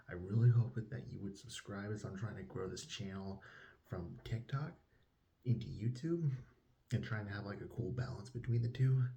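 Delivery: ordinary delivery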